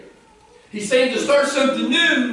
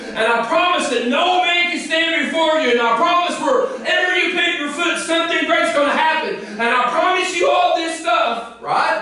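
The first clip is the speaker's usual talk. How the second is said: preaching at full force; 4 semitones higher than usual. Acted. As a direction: pound home the call to die to old ways. Preaching God's promise, full force.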